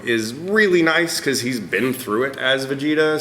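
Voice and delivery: ordinary delivery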